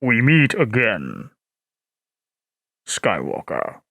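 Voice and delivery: very low, ominous bass; Darth Vader. Shown as a demonstration. Streamer voicing Vader confronting Skywalker, very deep. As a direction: greet the enemy like a dark lord. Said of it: "I sound like Vader".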